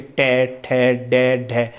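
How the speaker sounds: टै ठै डै ढै stretched out slowly, tongue curled back; teacher modelling retroflex stops; slow retroflex demo. Shown as a demonstration. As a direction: sound out टै, ठै, डै, ढै each one slow and separate. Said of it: "जीभ हमारे खुरदरे भाग से".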